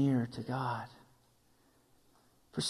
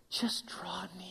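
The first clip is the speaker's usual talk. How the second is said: soft, unvoiced plea; whisper; 12 dB quieter, slower than usual. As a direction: breathe it as a gentle invitation. Soft invitation to congregation.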